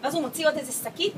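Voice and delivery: normal talking voice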